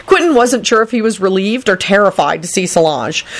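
plain reading voice

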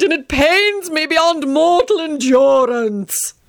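high, slow melodramatic lament; theatrical whining. Acted. Tony complaining to Teddy on the train, high melodramatic whine. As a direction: wail about his throat like a dying aristocrat.

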